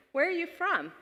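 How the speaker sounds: inquisitive friend. Retold or imitated. Linguistics student friend asks her origin, inquisitive.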